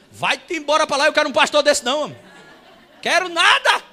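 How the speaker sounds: loud, high comic rant; mock indignation. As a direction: reject the pastor in hammed-up outrage. Acts out robbed churchgoer refusing visit. (indignação fingida, aos berros)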